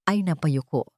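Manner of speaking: plain reading voice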